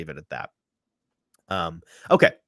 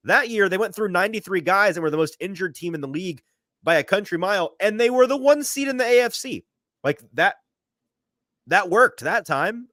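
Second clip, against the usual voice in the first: rising, louder, building to an emphatic point; heated rebuttal; 11 semitones higher, 4 dB louder than usual. Real, not acted. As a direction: push the argument harder with each phrase, climbing higher. Arguing passionately, voice rising.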